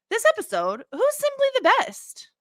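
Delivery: high sing-song award announcement; announcer voice. Acted.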